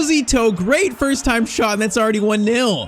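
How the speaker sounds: thrilled, then easing into the scoreline; excited goal call. Real, not acted. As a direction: burst out as the shot goes in. Goal scored in live commentary.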